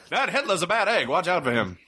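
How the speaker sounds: high, hammed-up 1940s newsreel announcer; old-timey newsreel voice. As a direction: boom it out like a wartime newsreel, laughing. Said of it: "Old, old-timey newsreels".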